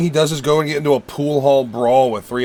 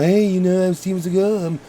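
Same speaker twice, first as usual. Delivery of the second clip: higher, mocking tough guy boast; Steven Seagal impression; 6 semitones higher than usual. Mocking Seagal's fake Italian persona, pitched up. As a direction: lampoon him bragging, pitched up. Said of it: "who talked like this".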